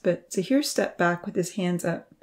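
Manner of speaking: usual speaking voice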